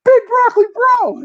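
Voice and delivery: high, loud shout across a lobby; calling out a nickname. Retold or imitated. Friend yelling a nickname across the casino, loud high call. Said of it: "all of a sudden I hear".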